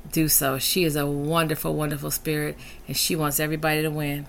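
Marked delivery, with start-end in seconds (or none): none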